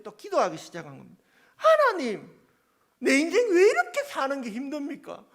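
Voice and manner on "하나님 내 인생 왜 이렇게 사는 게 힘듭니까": loud, much higher complaining cry to God; whining prayer. Retold or imitated. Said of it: "투정을 보면서".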